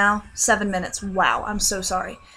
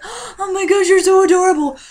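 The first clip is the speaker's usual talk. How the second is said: much higher, loud delighted squeal; gushing squeal; 10 semitones higher, 9 dB louder than usual. Retold or imitated. Dreamer squeals loudly at the adorable beagle. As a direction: squeal over the cute puppy.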